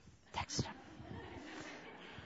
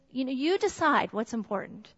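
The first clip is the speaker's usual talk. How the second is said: normal talking voice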